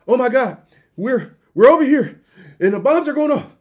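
high, loud mock panic; a leader losing it on camera, hammed up; panicking president. President panicking on the news, high and loud mock panic.

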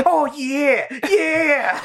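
high, hammed-up cries of passion; mock-orgasmic wailing, breaking into laughter; mock lovemaking. Mimics Cartman resuming lovemaking.

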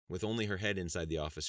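usual speaking voice

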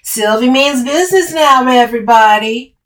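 loud, high mock announcement; taunting. A narrator's reading. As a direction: jeer as if to a crowd. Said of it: "Camille taunted".

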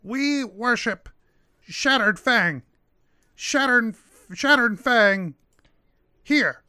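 high, slow kobold speech; little kobold in broken speech. Acted. GM voices the kobold.

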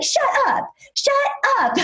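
very high, repeated gasping-fan exclamation; excited disbelief. Retold or imitated. Fan hears the host introduce herself, excited. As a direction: imitate a fan losing it, with a break before the last word. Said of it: "she was so excited to meet me".

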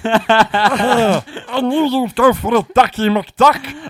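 slow, chuckling cartoon critter villain; raccoon villain voice. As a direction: gloat with a laugh like a cartoon raccoon. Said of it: "I just really appreciate your voice there".

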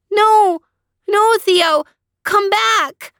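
put-on high, loud, panicked child's plea; desperate cry. A narrator's reading. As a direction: call after the dog in alarm. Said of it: "cried Leanie".

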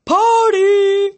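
high, loud, long-held whoop; drawn-out high cheer; wailing party cry. A narrator's reading. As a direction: belt it out like a party animal. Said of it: "a voice wailed".

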